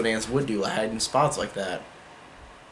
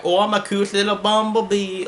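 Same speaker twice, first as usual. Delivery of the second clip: put-on much higher, dopey caterpillar voice with an accent; dopey Heimlich voice; 8 semitones higher than usual. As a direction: play it like a sweet, dim caterpillar. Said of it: "dopey kind of way he talks".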